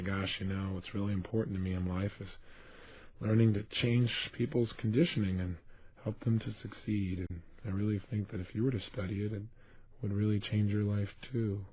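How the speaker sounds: low, soft, uninspired delivery; dispassionate. Shown as a demonstration. Speaker demonstrating unconvincing persuasion to listeners, dispassionate. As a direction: say it as if you don't believe it. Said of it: "talk in a non-inspired way, a dispassionate way".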